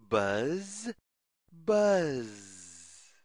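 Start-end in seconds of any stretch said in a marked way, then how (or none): none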